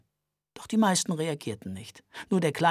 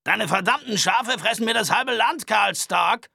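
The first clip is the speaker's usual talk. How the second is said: angry rancher; 7 semitones higher, 6 dB louder than usual. Acted. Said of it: "machte einen Satz rückwärts und kreischte".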